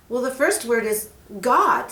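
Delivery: put-on girl Mary. Mary asks the snake for help, eager. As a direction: ask sweetly and eagerly.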